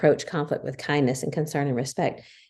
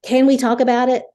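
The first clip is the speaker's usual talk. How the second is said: much higher and louder, sharp demand; harsh demand; 8 semitones higher, 8 dB louder than usual. Shown as a demonstration. Wife showing the harsh way to ask to talk. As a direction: snap the question out in a harsh tone. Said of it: "Or you can say".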